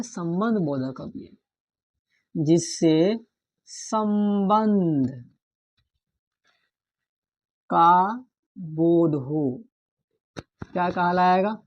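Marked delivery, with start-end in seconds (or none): 2.4-9.6 very slow, word by word; slow dictation. Shown as a demonstration. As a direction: spell the definition out slowly for notes. (धीरे-धीरे लिखवाना)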